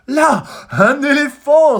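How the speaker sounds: very high, loud, slow, thrilled; exclaiming. A narrator's reading. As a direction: point it out in wonder. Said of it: "s'exclame Zigomar".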